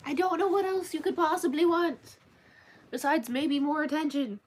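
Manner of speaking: high, loud exasperated pet talk. Real, not acted. Answering a cat that keeps meowing.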